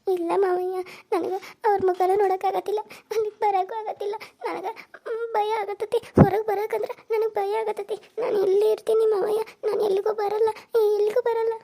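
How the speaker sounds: weeping, begging to stay inside; crying and scared. Acted. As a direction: sob that you are too afraid to go. Frightened wife refuses to go out.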